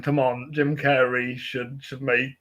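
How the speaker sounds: ordinary talk, nothing marked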